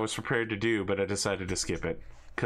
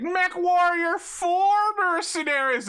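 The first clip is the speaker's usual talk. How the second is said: much higher, louder mock fanboy yell, drawn out; excited fan shout; 7 dB louder than usual. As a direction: shriek it like an overeager fan. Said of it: "somebody's gonna come up in here and be like".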